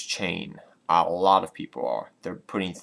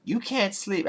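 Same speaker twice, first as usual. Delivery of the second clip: put-on girlfriend voice; 12 semitones higher than usual. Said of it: "She's like".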